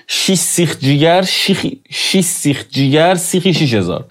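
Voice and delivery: شیش سیخ جیگر said slowly, every sound spelled out; slowly fix the tongue twister word by word; slow careful correction. Shown as a demonstration. Teacher correcting the tongue twister, slow and careful. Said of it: "همین الان اشتباه گفتم".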